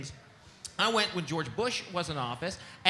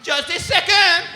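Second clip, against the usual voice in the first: high, loud, drawn-out secretary voice; hammed-up excited receptionist; mock secretary; 12 semitones higher, 13 dB louder, slower than usual. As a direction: sing out slowly to a visitor, high and loud. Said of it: "She goes".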